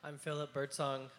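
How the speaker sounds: ordinary talk, nothing marked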